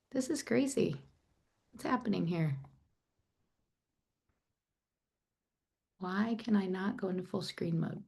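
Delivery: soft, puzzled talking to oneself; flustered self-talk. Real, not acted. Presenter struggling with full screen mode, quietly flustered. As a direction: drop the volume, troubleshooting to yourself.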